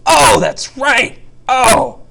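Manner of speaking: very loud, high, drawn-out exclamation; comic shouted outburst; mock realization. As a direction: act out a huge sudden remembering. Acting out sudden memory of shortcut.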